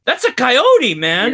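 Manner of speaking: retelling his startled reply to his wife on the phone; incredulous exclaim. Retold or imitated. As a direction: blurt it out in disbelief. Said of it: "And I'm like".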